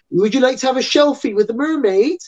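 put-on high-pitched, hammy West Country pirate accent; bad West Country accent. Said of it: "doing this really bad West Country accent".

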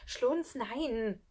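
urgent, held-back cry; alarmed protest. Acted. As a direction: try to stop a friend in alarm. Frightened boy protests.

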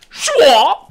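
one loud, high whooshing yelp; silly attack cry. Pretend. Player voicing his own game move, loud squeaky yelp. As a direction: yelp a squeaky action noise.

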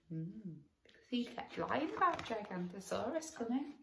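hushed quick aside; quiet aside. Real, not acted. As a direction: explain it softly aside. Parent aside explaining story.

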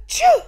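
gasping intake then a huge loud choo; giant sneeze. A narrator's reading. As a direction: sneeze it enormously. Snowman sneezed a gigantic.

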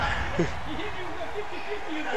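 high laugh. Real, not acted. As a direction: burst out laughing. Big laugh at a joke.

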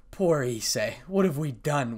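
plain reading voice

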